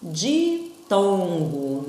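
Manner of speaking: ditongo stretched slowly, syllable by syllable; teacher stretching a new word; drawn out. Shown as a demonstration. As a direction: draw out ditongo for the class. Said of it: "eu tenho um".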